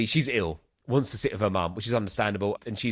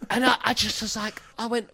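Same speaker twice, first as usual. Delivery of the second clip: ordinary talk, nothing marked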